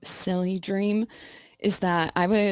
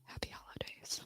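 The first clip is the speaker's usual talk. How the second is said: barely audible whispered greeting; faint whisper; 23 dB quieter than usual. Shown as a demonstration. Streamer whispering holiday wishes again, fainter. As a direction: let it fade to almost nothing. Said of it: "repeated whisper much quieter".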